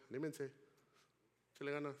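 soft, limp anímense, like a shrug; a weak, quiet pep talk brushed off; half-hearted cheer. Shown as a demonstration. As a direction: toss off a careless échale ganas. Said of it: "No dice".

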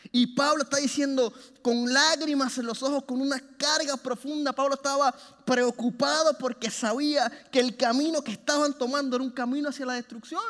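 rally the church with rising passion; preaching. Acted. Preacher rising to full exhortation.

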